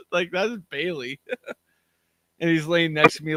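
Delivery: ordinary talk, nothing marked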